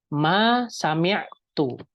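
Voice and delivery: Ma sami'tu said slowly, word by word; teacher slowly modelling an Arabic phrase; slow demo. Shown as a demonstration. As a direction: spell out Ma sami'tu slowly for students to repeat. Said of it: "teacher demonstrating Arabic phrase".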